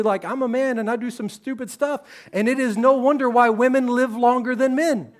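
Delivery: ordinary talk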